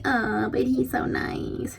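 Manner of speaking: high, slow, swooning mock sigh; mock dreamy. Swooning over a bad boyfriend, mockingly dreamy. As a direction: play the smitten girl, gently mocking. Mocking girls charmed by him.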